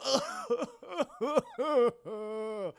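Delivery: high, put-on villain cackle; villain laugh. Kingpin laughs at the hero, hammed-up villain cackle. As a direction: cackle like a movie bad guy.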